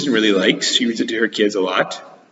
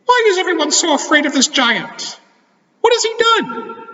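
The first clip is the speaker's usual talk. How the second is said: high squeaky cartoon mouse; Mickey Mouse voice. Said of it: "asked Mickey".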